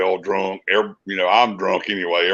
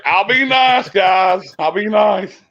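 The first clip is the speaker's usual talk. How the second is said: much higher, mock-innocent plea; pleading promise; 9 semitones higher, 3 dB louder, slower than usual. Promising staff he'll behave, high mock-innocent plea. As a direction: promise sweetly like a naughty kid. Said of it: "And I'll be like".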